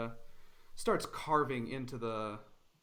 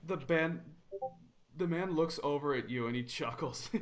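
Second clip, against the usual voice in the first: ordinary talk, nothing marked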